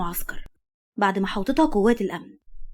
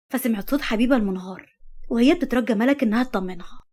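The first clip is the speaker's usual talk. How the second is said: ordinary delivery, nothing marked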